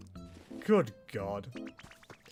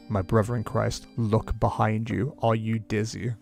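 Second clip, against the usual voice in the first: deadpan exasperated; 8 semitones lower, slower than usual. Acted. Exasperated reply to character.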